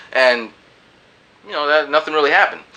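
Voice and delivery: ordinary delivery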